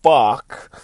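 very loud, hugely drawn-out shock; utter shock. Acted. Storyteller voices Casey seeing the uncovered face, loud stretched shock. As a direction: stretch each word out loud and high, stunned. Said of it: "in a fucking pure look of".

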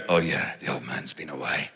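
low, soft mock aside; kids muttering. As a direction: mutter it under your breath. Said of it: "they'd be going".

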